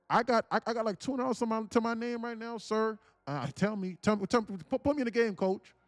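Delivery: half-shouted mock plea for orders; mock pleading rant. Ranting at brother, begging sarcastically.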